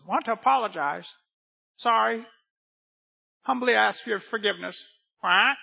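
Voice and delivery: high parrot voice, apologizing. Acted. Freed parrot begging its owner's forgiveness, high bird voice. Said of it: "Parrot comes out".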